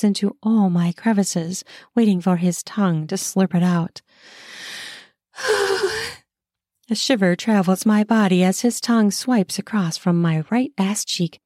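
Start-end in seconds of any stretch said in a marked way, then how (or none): 5.4-6.1 high pleasured sigh. A narrator's reading.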